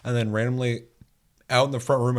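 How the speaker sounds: ordinary talk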